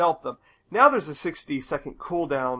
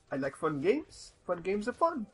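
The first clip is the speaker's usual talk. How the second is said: mock Scottish accent; 4 semitones higher than usual. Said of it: "I don't know what accent that was".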